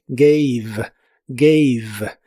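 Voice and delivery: "Gave" with an "ei" vowel and a silent final E; "gave" said as "geiv"; ei sound. Shown as a demonstration. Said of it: "se pronuncia como ei".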